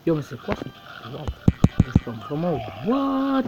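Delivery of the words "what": surprised. Real, not acted. Amazed by game.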